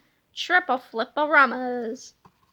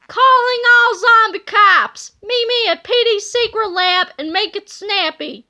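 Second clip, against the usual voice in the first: calling out; 4 semitones higher, 7 dB louder than usual. Acted. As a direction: summon the zombie cops. Petey calls his zombies.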